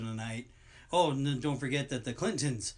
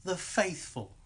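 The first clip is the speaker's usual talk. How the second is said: higher preacher voice on "The faithful"; southern preacher; 6 semitones higher, slower than usual. Retold or imitated. Quoting the show's edit of the catchphrase in a higher preacher voice. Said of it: "the editor in this T V thing".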